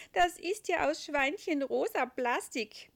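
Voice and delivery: high, loud snort of laughter into the line; bursting into laughter. Acted. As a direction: laugh out the words. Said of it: "prustet der Engel los".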